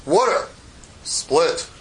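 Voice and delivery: lightly voiced God, word by word; God giving a two-word order, pause between; God's commanding voice. God orders the sea to part, lightly voiced commanding voice. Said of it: "I guess that's the way he talks".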